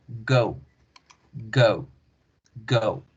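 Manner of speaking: teacher modelling go; English go. Shown as a demonstration. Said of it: "Fíjense, ahí está el diptongo".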